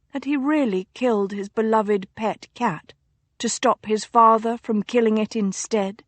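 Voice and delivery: ordinary delivery, nothing marked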